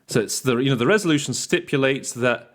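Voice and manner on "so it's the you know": usual speaking voice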